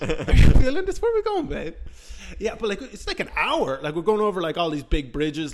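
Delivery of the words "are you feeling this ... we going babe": high-pitched, hammed-up come-on; mock lovestruck drunk, high and excitable; retold flirting. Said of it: "who I start flirting with".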